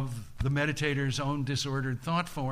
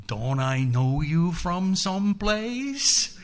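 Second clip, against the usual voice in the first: slow, higher question climbing to the end; knowing challenge; 4 semitones higher, 3 dB louder, slower than usual. Acted. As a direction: draw it out as mock puzzlement, rising steadily. Buddha challenges the demon's sons.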